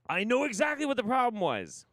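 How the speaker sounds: loud, high triumphant; loud excited. Acted. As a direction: shout it out in triumph.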